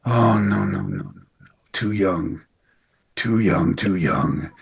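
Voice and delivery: low, hushed doctor's lament; grim anesthesiologist. Retold or imitated. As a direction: pronounce a soft, low, gloomy verdict. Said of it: "he's got this voice".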